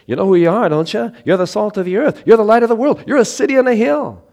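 high, loud preached declaration; loud, high pulpit proclamation; declaring. Acted. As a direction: proclaim it like a rising call.

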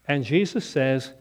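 normal talking voice

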